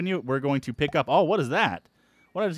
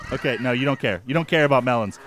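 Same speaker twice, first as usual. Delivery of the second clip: ordinary delivery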